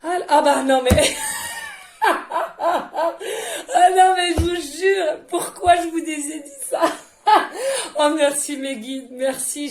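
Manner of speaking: loud, high laughing shout; real laughter breaking through; emotional laughing outburst. Real, not acted. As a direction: laugh out loud, overcome with feeling. Overwhelmed by emotion, bursting out.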